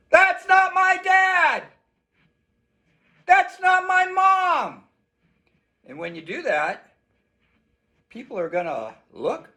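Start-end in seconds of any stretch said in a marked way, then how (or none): 0.1-4.8 scream for help. Shown as a demonstration. Child grabbed by a stranger screams for help, much higher shout. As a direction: yell it so everyone turns to look. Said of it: "is to scream".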